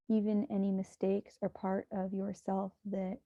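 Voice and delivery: hushed, even meditation guidance; guided meditation. Acted. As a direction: guide listeners gently into breath and body.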